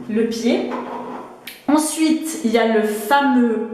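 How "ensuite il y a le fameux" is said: usual speaking voice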